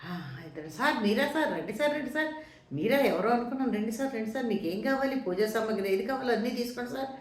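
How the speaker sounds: high-pitched, fawning welcome to a big man; pleading. Retold or imitated. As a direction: act out an eager, repetitive plea to please. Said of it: "బతిమాలేసి బతిమాలేసి". (బతిమాలుతున్నట్టు హెచ్చు స్వరంలో)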